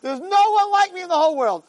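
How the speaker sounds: high-pitched, hammed-up drunk bragging; boastful drunken lion. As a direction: crow like a drunk who thinks he's unbeatable. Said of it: "so you become a lion".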